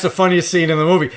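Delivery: usual speaking voice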